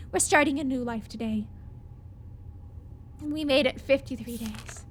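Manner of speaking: tired, low voice; exhausted. Real, not acted. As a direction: let the voice sag with fatigue. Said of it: "My voice is, like, tired".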